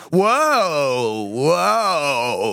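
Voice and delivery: long drawn-out whoa, swooping up and falling; rider calling whoa. Acted. Imagining co-host bouncing on horseback, drawn-out rider's whoa. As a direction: draw each one out to halt a horse. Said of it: "bouncing up and down, going".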